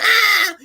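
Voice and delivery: high mock "Ah!"; imitated scream. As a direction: let out a high-pitched comic scream. Imitating goblin bomb moment in trailer.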